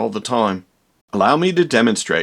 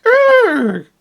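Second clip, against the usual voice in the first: a high, strained, louder grunted er; higher monster grunt; 5 dB louder than usual. Retold or imitated. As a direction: squeeze out a strange high er. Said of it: "No, it was more like".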